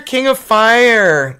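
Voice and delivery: King of Fire said slowly, stretched and loud; drawn out. Real, not acted. Repeated slower, drawn out.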